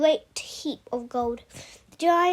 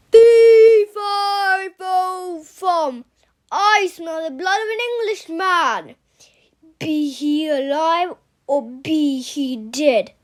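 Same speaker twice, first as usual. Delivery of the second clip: loud, slow booming giant voice; the giant's menacing rhyme, loud and drawn out; 10 dB louder, much slower than usual. Acted. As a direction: boom it out like a hungry giant sniffing for prey. Giant's rhyme read aloud.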